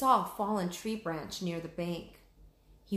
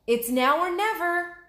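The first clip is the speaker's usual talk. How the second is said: much higher, louder determined call; urgent resolve; 11 semitones higher, 8 dB louder than usual. Acted. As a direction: summon all strength in one cry. Jack about to pull hard.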